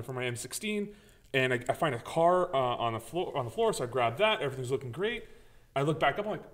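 ordinary talk, nothing marked

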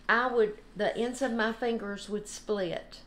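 usual speaking voice

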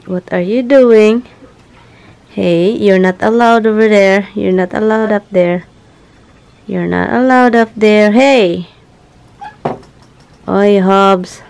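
sharp pet-owner reprimand; scolding pets. Real, not acted. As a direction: scold them off the furniture, firm. Scolding pets climbing furniture.

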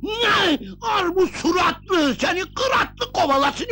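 high-pitched furious shouting; comic raging tirade; angry outburst. Acted. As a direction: explode at her, voice jumping up. Master rages at the woman.

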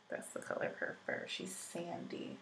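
murmuring about the dog's fur under her breath; whispered aside. Real, not acted. Vlogger whispers to herself about her dog's sandy fur.

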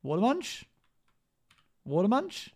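high questioning call. Real, not acted. Calling out to a player.

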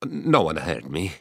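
ordinary delivery

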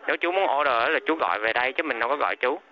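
ordinary talk, nothing marked

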